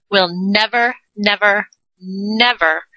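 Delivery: never, never, never each punched; stress on never. Shown as a demonstration. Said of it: "emphatic repetition".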